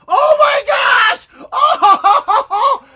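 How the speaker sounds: ordinary talk, nothing marked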